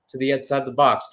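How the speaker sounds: normal talking voice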